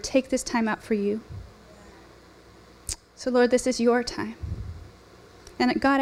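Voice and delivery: hushed, earnest prayer to God. Real, not acted. Opening prayer before sermon.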